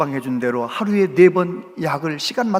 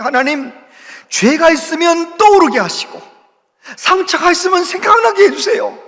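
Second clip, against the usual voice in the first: higher, louder pleading prayer; earnest prayer; 9 semitones higher, 5 dB louder than usual. Retold or imitated. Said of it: "하나님 앞에 이렇게 기도했대요".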